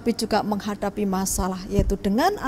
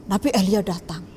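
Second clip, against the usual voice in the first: plain reading voice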